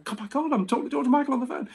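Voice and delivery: high, starstruck inner gasp. Retold or imitated. Inner panic hearing [name].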